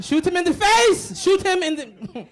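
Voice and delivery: high, loud, slow laughing call to shoot; laughing shout. Acted. Comic imagining Melania's order to shoot Trump, laughing loudly. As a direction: cackle out the order.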